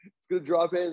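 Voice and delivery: imitating a golfer freaking out, high and excited. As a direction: gush like a stranger watching his ball almost drop. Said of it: "So he's, like, freaking out".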